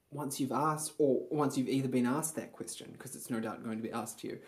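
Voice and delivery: ordinary delivery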